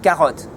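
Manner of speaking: carotte with a guttural French R, ka-ROT; teacher modelling carotte; French accent carotte. Shown as a demonstration. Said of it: "just say it with a French accent".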